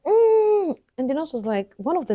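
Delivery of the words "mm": a loud, high straining hum; straining push grunt. Retold or imitated. New mother reenacting her first push, loud straining hum. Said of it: "I was like".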